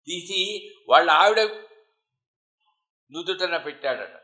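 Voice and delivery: ordinary talk, nothing marked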